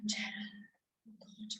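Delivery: barely a whisper. A narrator's reading. Storyteller slowing before Gerald's thought, whispered. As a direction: breathe the words out, hushed. Hushed narration.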